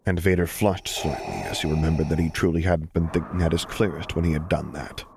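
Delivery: ordinary talk, nothing marked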